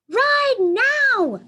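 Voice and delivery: high, loud demanding shout; commanding child. A narrator's reading. Baby gorilla Kudzu demanding a ride, high loud command. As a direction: bark it like a bossy little gorilla. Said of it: "Kudzu repeats".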